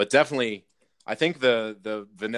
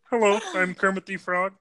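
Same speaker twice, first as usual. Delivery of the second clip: high, bright frog puppet voice; Kermit the Frog voice; 10 semitones higher, 4 dB louder than usual. Shown as a demonstration. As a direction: do your best Kermit. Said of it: "I can do a really good Kermit the Frog voice".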